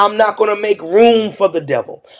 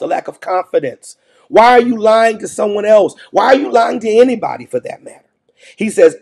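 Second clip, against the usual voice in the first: ordinary talk, nothing marked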